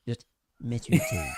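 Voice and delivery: bottom jaw jutting, low and soft; underbite. Shown as a demonstration. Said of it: "just gotta stick your bottom jaw out".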